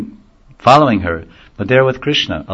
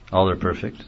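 ordinary talk

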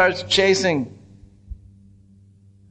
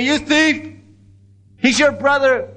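mock angry accusation; 8 semitones higher than usual. Milkman accuses the thief's brother, mock angry. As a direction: imitate an accusing milkman, pitch jumping up.

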